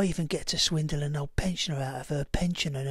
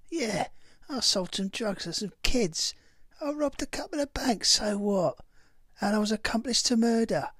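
put-on cockney accent, pitched higher and louder in defiance; defiant outburst; 7 semitones higher, 3 dB louder than usual. Ex-gang member defends his crimes in a defiant cockney outburst. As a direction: fling out each crime with a defiant so what.

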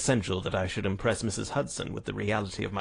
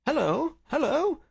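high, louder hail; calling out; 5 dB louder than usual. A narrator's reading. Detective greeting footsteps outside, high bright call. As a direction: sing out a high, lifted greeting. Holmes hears a friend arriving.

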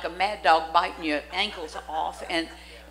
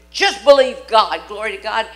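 loud exhortation; 2 semitones higher, 11 dB louder than usual. Retold or imitated. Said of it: "they tell you".

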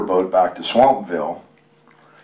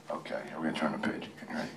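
soft whisper, quicker; 5 semitones lower, 18 dB quieter, much faster than usual. Real, not acted. Father whispering to his son while turning the page. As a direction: whisper it to the little one. Whispering to the child beside him.

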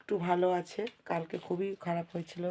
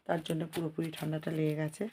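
ordinary delivery

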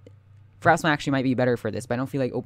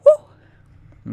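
very high loud cheer; relieved whoop; 11 dB louder than usual. Real, not acted. Escaping death.

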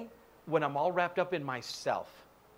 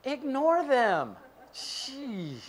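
exasperated command; slow emphatic; 7 semitones higher, much slower than usual. Real, not acted. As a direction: lay it out slow and firm. Exasperated advice.

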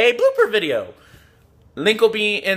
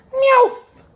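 very high little squeal of the name; squeaky name call. Acted. Calls out the card's name.

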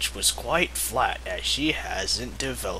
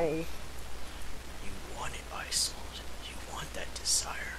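unvoiced, much softer come-on; seductive whisper; 2 semitones lower, 13 dB quieter than usual. Wolf tempts Isolde in a hammed-up whisper.

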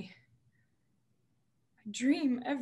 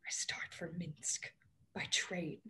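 weary whisper; 4 semitones lower, 10 dB quieter than usual. Acted. Weary confession of her life.

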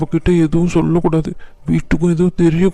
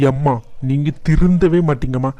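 normal talking voice

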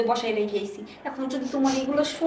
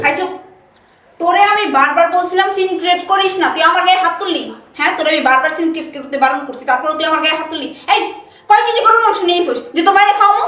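furious shouting; 3 semitones higher, 9 dB louder than usual. Acted. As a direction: scream it at your husband. Wife erupts in quarrel. (স্বামীর ওপর রেগে চিৎকার)

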